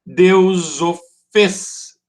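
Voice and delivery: "Deus o fez" very slow, stretched beat by beat, emphatic; syllable-count demo. Shown as a demonstration. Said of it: "duas sílabas".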